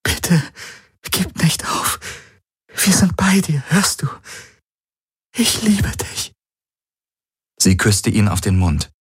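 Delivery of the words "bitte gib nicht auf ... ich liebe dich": tender whisper. Acted. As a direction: breathe the plea close to his ear. Pleading whisper at bedside.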